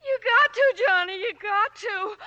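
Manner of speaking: tearful, desperate begging, voice breaking; crying and begging; crying plea. Acted. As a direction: sob it out as you beg him not to leave you. Nora begs Johnny to take her.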